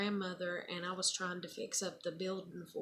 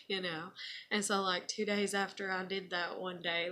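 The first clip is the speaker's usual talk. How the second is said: ordinary talk, nothing marked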